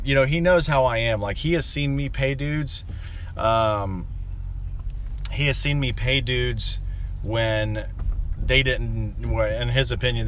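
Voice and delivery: ordinary delivery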